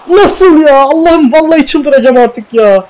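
very high, loud cry of disbelief; exasperated outburst. Real, not acted. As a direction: explode over yet another miss. Another chance missed.

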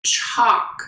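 chalk whispered, leaning on the ch; sounding out words. Shown as a demonstration. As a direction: breathe out chalk, stressing the opening ch. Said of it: "This one's a little bit trickier".

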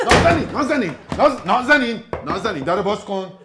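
loud, high pleading at a shut door; begging her to open up; husband calls to his wife behind the door; calling through the door. Acted.

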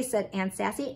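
ordinary delivery, nothing marked

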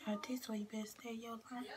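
hushed whisper aside. Real, not acted. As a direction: whisper it under breath. Whispered aside while reading.

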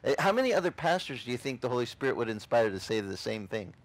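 usual speaking voice

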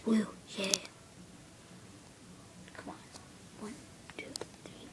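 hushed whisper. Acted. To his friend before jumping on, a whisper. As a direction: whisper the countdown. Sneaking countdown.

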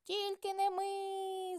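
high, slow squeaky mouse cry; lazy mice refusing, squeaky and high; mice shouting. A narrator's reading. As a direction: squeal it out, drawn out and stubborn. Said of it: "закричали круть і верть".

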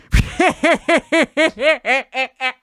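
high, rapid staccato cackle; long rattling giggle; cracking up. Real, not acted. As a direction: laugh at your own joke in quick high bursts. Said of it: "I cracked myself up".

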